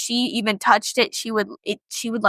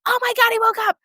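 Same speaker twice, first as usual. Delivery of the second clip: very high, squealed alarm; high-pitched retold shock; mock panic; 12 semitones higher, much faster than usual. Panicked reaction as he wakes.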